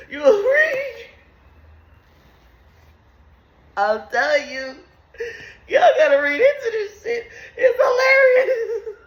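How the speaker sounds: can barely get it out laughing; laughing hard. Real, not acted. Telling viewers the Bible is funny, high laughing squeal.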